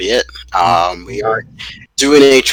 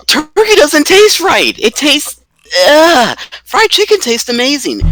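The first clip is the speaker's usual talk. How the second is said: exasperated squeal; 14 semitones higher than usual. Real, not acted. Disgusted rebuttal in food argument.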